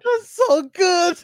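high, loud, drawn-out laughing squeal; cracking up. Real, not acted. Joke teller delighted by his own pun, cracking up. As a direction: squeal it through helpless laughter.

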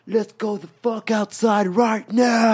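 high, stretched-out rallying yell; wild, amped-up battle cry; hyped-up shout. Retold or imitated. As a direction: holler it, bursting with energy. Acting out wired burst of energy.

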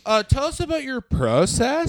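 high, slow, put-on podcaster voice; mock interviewer. Mock podcast interviewer teasing the co-host.

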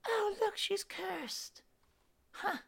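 high, sly mocking taunt; villain's mocking voice. Villain taunting the cursed player, high and mocking. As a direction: sneer down at the cursed one.

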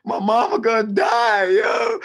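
high-pitched pleading prayer, comically wailed; hammed-up desperate plea; desperate praying. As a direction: beg for help, climbing into a drawn-out wail. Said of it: "I start praying".

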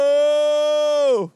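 very slow loud shout; shouted drawn out. Retold or imitated. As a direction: stretch the word, yelling. Chanting punchline together.

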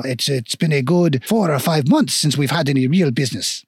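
regretful mock-French shopkeeper; lilting, hammy French dwarf; bad French accent. Dwarf shopkeeper explains to customer trade is gone, bad French accent. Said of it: "very shitty French accent".

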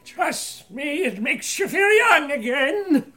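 high, loud cackling crone voice; old woman shouting. Acted. Old woman chasing a suitor with her parasol, high shout. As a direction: shriek it like a hobbling old lady.

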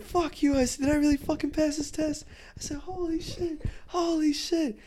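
overjoyed mock outburst; ecstatic disbelief. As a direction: screech it in total disbelief. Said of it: "I started cursing".

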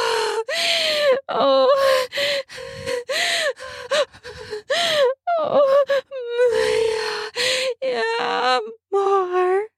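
high crying moans of pleasure; ecstatic moans. Acted. Climax moans during sex.

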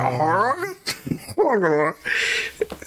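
high, drawn-out mock gulping howl; exaggerated cartoon swallowing sound; imitated gobbling noise. Teller imitating Scooby gulping corn whole, exaggerated gulping noise.

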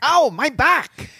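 high, slow German accent. Shown as a demonstration. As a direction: stretch "Ow, my back" out in a German accent. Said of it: "right after me in a German accent".

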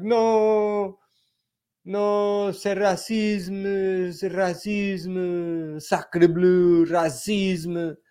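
high, slow French accent, hammed-up; mock French accent. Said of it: "she's like".

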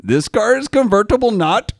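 high, loud mock Borat accent. Mocking a buyer's complaint in a loud, high Borat accent. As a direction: ham up a Kazakh Borat voice with a punchline not. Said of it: "Forgive me for saying it in an accent ... assume you're Borat".

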